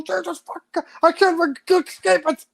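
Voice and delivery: high-pitched, exasperated mock curse; exasperated outburst. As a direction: groan it out, sick of hearing about it. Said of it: "I go".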